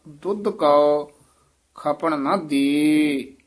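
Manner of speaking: ਦੁੱਧ ਕੋ ਖਪਣ ਨਾ ਦੇ said slowly with a soft unstressed ਖਪਣ; softly pronounced. Shown as a demonstration. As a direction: ease the word ਖਪਣ gently, not ਖੱਪਣ. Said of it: "ਪੋਲਾ".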